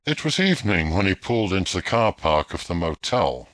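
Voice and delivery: ordinary delivery, nothing marked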